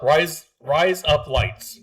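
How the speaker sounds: deliberate, drawn-out Australian-accent try. Shown as a demonstration. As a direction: test the fake Australian accent word by word. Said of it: "doesn't sound like I'm even saying those three words".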